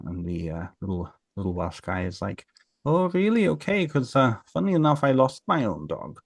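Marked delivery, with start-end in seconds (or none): 2.8-6.2 high-pitched, coy "oh, really?"; feigned surprise. Retold or imitated. Little Welsh guy to farmer about his lost dog, feigned surprise. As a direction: play innocent, pitched well up.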